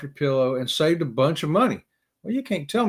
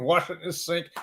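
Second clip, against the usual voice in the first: usual speaking voice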